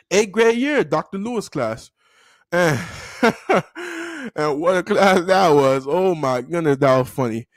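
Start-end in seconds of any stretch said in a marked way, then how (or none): 2.8-4.3 high-pitched burst of laughter; amused laugh. Real, not acted. Storyteller remembering Doctor Lewis's class, amused laugh. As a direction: crack up at the memory.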